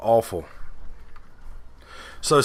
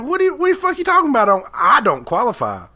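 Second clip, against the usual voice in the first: much higher, fast indignant outburst; outraged retort; 11 semitones higher, 4 dB louder, faster than usual. Retold or imitated. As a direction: explode in mock disbelief at the agent. Retold outrage at support agent.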